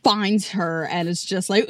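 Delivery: usual speaking voice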